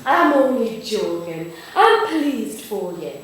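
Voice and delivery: slow, drawn-out grandmother voice; reassuring Nana. Acted. Nana makes up to teased granddaughter, slow and reassuring. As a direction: soothe after teasing, taking your time.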